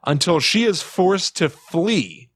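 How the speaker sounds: ordinary talk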